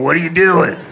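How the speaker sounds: creepy window guy. Pretend. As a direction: call up like a creep outside the window. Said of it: "the guy outside your window going".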